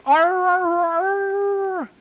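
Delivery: high, wailing imitated howl, held out; eerie howl. Telling friends about the UFO night, imitating the eerie high wail. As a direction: mimic an unearthly droning wail. Imitating the strange sky noise.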